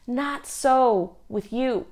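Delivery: slow calm reply; slow it down and settle; Jesus firmly. Retold or imitated. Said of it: "Jesus says".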